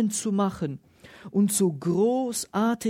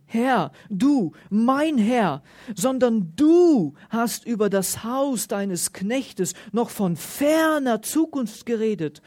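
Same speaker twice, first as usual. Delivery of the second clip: solemn Bible reading, stressing du and Herr; emphatic preacher's scripture read; 3 semitones higher than usual. Acted. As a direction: read the prayer out to the congregation.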